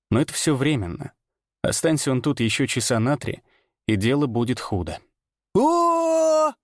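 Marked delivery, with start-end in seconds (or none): 5.5-6.5 booming showman's exclamation; loud carnival barker. Acted. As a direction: call out big like a fairground barker. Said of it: "Голос был громким, раскатистым, как у ярмарочного зазывалы".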